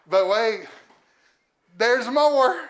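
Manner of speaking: loud, rising TV-sales build; infomercial pitchman. Acted. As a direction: shout it like a late-night ad. Infomercial catchphrase while unboxing gifts.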